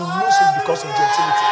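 high shouted climax; preaching shout. Real, not acted. Preacher calling the church to shout hallelujah, full shout. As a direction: explode into the call.

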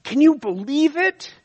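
outraged gossip. Retold or imitated. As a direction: sell the shock of the news. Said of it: "And they tell you".

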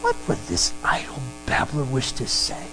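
low, quiet mutter of disdain; low-voiced scoffing aside; skeptical onlookers. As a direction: quote the doubters in a low, hushed voice. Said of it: "Some are saying".

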